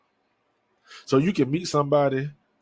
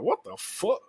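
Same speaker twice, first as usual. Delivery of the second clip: exasperated disbelief; 11 semitones higher, 4 dB quieter, slower than usual. Retold or imitated. Man retelling his reaction to her answer, exasperated disbelief. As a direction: retell a baffled reaction, voice jumping up. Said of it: "that made me so mad".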